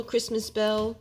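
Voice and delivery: ordinary delivery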